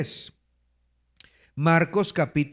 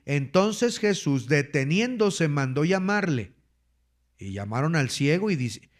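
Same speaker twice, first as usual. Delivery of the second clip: ordinary talk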